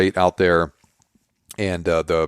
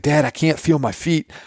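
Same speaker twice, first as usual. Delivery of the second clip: put-on higher whiny child voice; whiny kid; 7 semitones higher than usual. Son complaining to dad, lightly voiced whiny kid. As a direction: play the panicking son.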